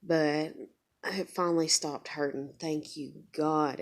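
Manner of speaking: ordinary talk, nothing marked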